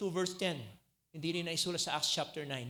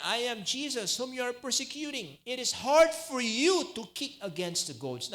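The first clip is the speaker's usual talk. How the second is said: forceful voice of Jesus; 8 semitones higher, 7 dB louder than usual. Acted. Preacher voicing Jesus forcefully.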